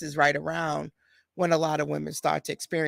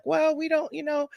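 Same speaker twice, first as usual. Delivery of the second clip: doctor brushing off; 10 semitones higher than usual. Retold or imitated. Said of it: "she too was like".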